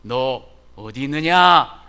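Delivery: very loud, slow calling out; God calling Adam in Genesis, loud and slow. Retold or imitated. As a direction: call to Adam across the garden. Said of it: "하나님께서 아담에게".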